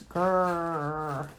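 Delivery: low growling dog noise; gruff bulldog voice. A narrator's reading. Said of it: "all Uncle Andrew heard was".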